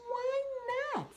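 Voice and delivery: put-on a kid frog protesting; squeaky indignant child; little frog whine.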